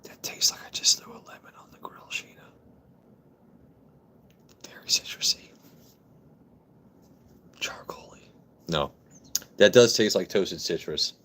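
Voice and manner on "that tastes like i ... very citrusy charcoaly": quiet whispered tasting notes; whispering. Real, not acted.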